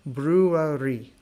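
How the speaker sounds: brewery slowly, soft gliding R. Shown as a demonstration. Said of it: "This is a very soft R in English".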